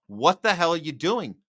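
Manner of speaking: ordinary delivery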